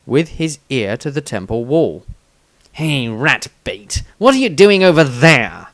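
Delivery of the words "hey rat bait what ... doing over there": calling out louder and higher; a rough hostile jab at the halfling. A narrator's reading. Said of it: "with hostility at the fore".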